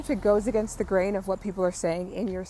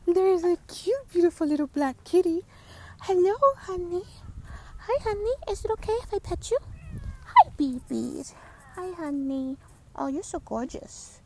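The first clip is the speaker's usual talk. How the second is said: much higher, sing-song pet talk; cooing at a cat; 12 semitones higher than usual. Real, not acted. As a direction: coo to a cat in a high, sweet voice. Talking to a neighborhood cat.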